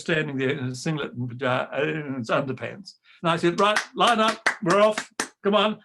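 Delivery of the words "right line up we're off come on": raised, higher call to line up; brisk command. Retold or imitated. Choirmaster calling the boys to line up, brisk and raised. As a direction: snap them into line.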